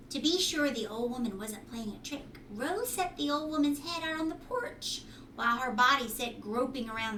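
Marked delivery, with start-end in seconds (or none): none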